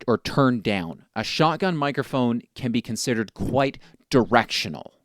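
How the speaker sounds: ordinary delivery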